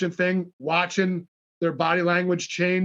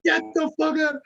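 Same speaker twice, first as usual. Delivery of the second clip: excited disbelief; 11 semitones higher than usual. Retold or imitated. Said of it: "they're like".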